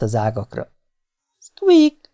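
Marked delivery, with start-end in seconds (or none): 1.4-2.1 very high, short squeak; high-pitched little rodent squeak; dormouse call. A narrator's reading. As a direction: imitate a dormouse to lure it. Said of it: "mondta halkan".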